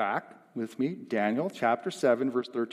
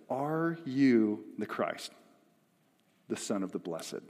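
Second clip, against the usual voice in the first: slow, deliberate question; measured interrogation, falling at the end; 4 semitones lower, 5 dB quieter, slower than usual. Acted. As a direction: draw out each word of the question. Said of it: "the high priest looks right at Jesus".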